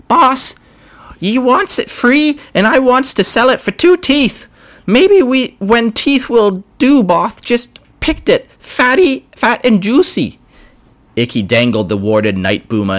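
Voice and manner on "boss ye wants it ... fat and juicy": much higher squeaky goblin voice; a nervous goblin pleading with the boss; grot voice. Acted.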